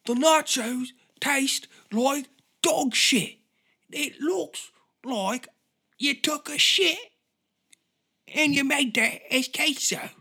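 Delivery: very high, slow, loud British caricature; exaggerated scolding in a put-on British accent; Gordon Ramsay. Gordon Ramsay berating a Mexican restaurant chef, slow high outrage. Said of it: "And he's like".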